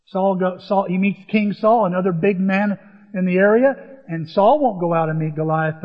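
normal talking voice

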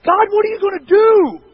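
high, loud desperate yell; re-enacted frantic shout to God. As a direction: yell it upward as an exasperated prayer. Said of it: "And I'm yelling in the truck".

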